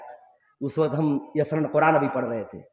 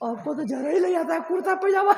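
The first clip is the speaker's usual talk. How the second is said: much higher put-on father's voice; voicing father; 13 semitones higher than usual. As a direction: lift your voice into the old father's. (पिता की ऊँची नकल की आवाज़)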